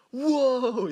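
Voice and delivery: high, loud startled whoa. Retold or imitated. Cousin's friend yelps at the bloody kid. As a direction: blurt it out in shock, way up high. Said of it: "He was like".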